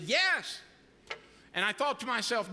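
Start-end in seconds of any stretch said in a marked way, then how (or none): 0.1-0.6 higher and louder, a quick eager yes. Retold or imitated. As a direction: shoot the answer out like an eager teen. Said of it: "Dude popped right up and said".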